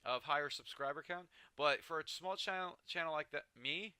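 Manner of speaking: usual speaking voice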